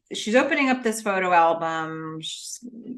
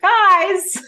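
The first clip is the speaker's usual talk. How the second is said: high, loud mock protest; mock exasperation; 12 semitones higher, 8 dB louder than usual. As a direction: call it out in hammed-up dismay. Joining the mock exasperated reaction.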